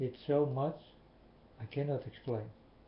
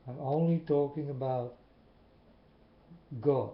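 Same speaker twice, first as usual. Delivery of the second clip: emphatic loud declaration; 2 semitones higher, 5 dB louder than usual. Real, not acted. Insists he speaks only of God.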